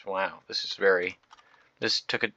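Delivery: normal talking voice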